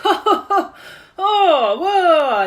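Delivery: caught-out dad yelping, half laughing; startled yelp. Retold or imitated. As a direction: jump back with a laughing shriek. Dad startled by officer's reaction.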